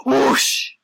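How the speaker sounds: loud, rushing whoosh; vanishing whoosh. Acted. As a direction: make someone vanish with a burst of air. Bull vanishes on saying five.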